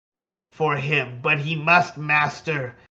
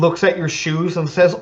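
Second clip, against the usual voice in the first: normal talking voice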